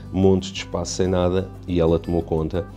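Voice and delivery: ordinary talk, nothing marked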